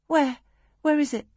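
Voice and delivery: put-on girl anxiously asking her mother about the painting; urgent. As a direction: demand to know where it is.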